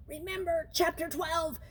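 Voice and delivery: teacher calling over the class. Acted. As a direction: call it out to the room. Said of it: "the teacher called".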